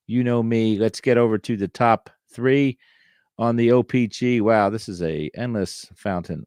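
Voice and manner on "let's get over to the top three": ordinary delivery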